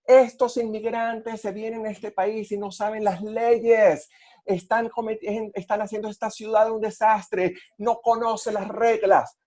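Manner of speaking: mild mock yell of an angry driver; retold shouting woman. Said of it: "la mujer empezó a gritar".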